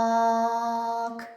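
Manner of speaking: «мак» drawn out loud, the а held long; stretched vowel. Shown as a demonstration. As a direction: hold the а in «мак». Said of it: "Другий звук". («мак» з протяжним а)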